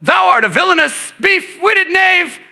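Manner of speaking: lightly voiced Shakespearean actor drawing out a slow insult; mock Shakespearean insult. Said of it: "screaming Shakespearean insults at their bumper".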